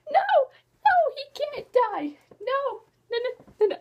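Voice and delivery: high, loud wailing protest; hammed-up wail of disbelief; distressed denial. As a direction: plead against bad news in a high voice. Acts out her denial while reading.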